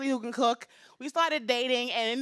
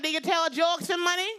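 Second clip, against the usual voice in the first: put-on higher-pitched Jamaican-accented questioning; Jamaican mother; 4 semitones higher than usual. Mom interrogating her comedian daughter, high Jamaican accent. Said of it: "her very heavy Jamaican accent".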